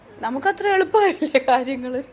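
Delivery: teasing through laughter; laughing. Real, not acted. Parent teasing daughter, laughing. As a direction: laugh while you tease.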